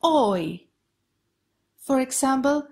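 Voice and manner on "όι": "oi" as one falling glide, o into ee; "oi" said by itself, as in boy; diphthong demo. Shown as a demonstration. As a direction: model "oi" alone with a closing fall. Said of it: "let's go to some other diphthongs".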